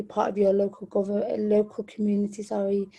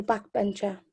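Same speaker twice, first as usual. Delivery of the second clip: ordinary delivery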